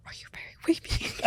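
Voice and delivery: hushed whisper of a shy fan; imitated whisper. Quoting a fan who whispered to him in a store. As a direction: whisper the question secretly. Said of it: "why are we whispering".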